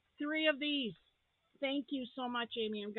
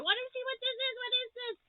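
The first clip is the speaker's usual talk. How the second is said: excited; 13 semitones higher, 4 dB louder, faster than usual. Real, not acted. Excited unboxing gift.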